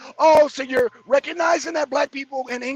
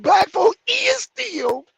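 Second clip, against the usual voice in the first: mocking; 8 semitones higher, 5 dB louder, much slower than usual. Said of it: "mocking grammar again".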